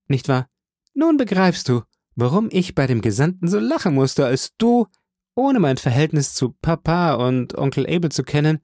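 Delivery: put-on lively, high young woman's voice; bright excited young woman. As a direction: gush it out happily. Portia explains, cheerful and excited.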